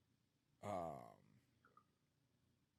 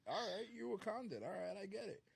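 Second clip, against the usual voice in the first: teasing impression; 13 semitones higher, 4 dB louder, faster than usual. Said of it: "she was like".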